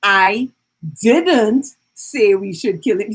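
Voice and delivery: "didn't" set apart after a pause and stretched out; slow demo with heavy emphasis on "didn't"; stress on DIDN'T. Shown as a demonstration. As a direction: punch the word "didn't", then run on. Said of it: "emphasize a different word".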